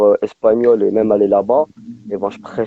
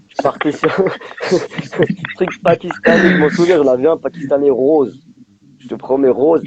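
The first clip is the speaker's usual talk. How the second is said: amused laughing; 5 semitones higher, 3 dB louder than usual. Real, not acted. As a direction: break into laughter, higher and louder. Rit de son ancienne tenue. (en riant, amusé)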